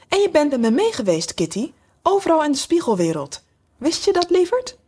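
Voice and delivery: high sweet voice rising as she talks to the kitten; cooing to kitten. A narrator's reading. Alice cooing to her kitten.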